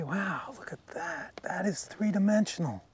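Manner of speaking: ordinary delivery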